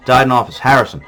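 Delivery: ordinary talk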